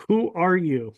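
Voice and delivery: ordinary talk, nothing marked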